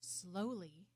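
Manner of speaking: plain reading voice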